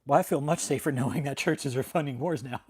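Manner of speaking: laughing, dripping with sarcasm; sarcastic. Real, not acted. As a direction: say it with a mocking laugh. Mocking church funding wars after call.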